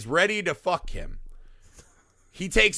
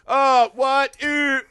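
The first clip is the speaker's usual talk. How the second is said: mock shock; 8 semitones higher, 5 dB louder, much slower than usual. Mocking the dad's fake protest.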